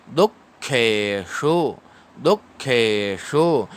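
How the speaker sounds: दुखेषु slowly with a retroflex shu; slow recitation. Shown as a demonstration. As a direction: draw out each syllable of दुखेषु. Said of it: "तो दु".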